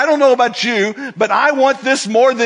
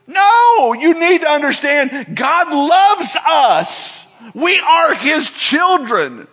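preaching at full force; 7 semitones higher, slower than usual. Acted. Preacher to congregation, preaching at full force. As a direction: drive it home from the pulpit.

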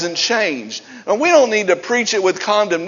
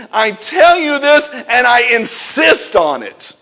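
loud, raised, insistent declaration; emphatic insistence; 3 semitones higher, 5 dB louder than usual. Acted. Preacher quoting Paul's letter to the church, emphatic. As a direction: drive it home with force. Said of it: "This is a very emphatic statement".

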